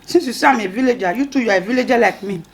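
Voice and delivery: ordinary talk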